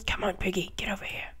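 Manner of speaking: whispered coaxing. Real, not acted. Player coaxing a pig closer, whispering.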